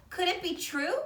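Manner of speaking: plain reading voice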